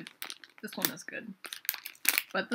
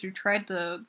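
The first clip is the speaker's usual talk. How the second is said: ordinary talk, nothing marked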